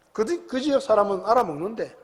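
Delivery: ordinary delivery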